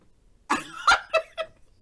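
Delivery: loud high-pitched cackle; high squealing laugh. Real, not acted. Telling viewers about the show, a squealing laugh. Laughing at her own story.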